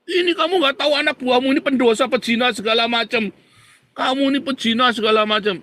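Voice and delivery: loud, high-pitched angry accusation; acted raging. Pak Iman rejects the messenger as a sinner, raging. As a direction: play an irate man railing at the messenger. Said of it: "Pak Iman ini ngamuk-ngamuk".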